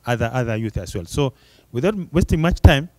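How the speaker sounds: normal talking voice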